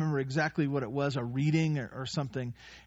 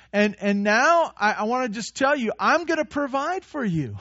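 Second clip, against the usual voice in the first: impassioned promise; 6 semitones higher, 4 dB louder than usual. Acted. Jesus voiced reassuring disciples.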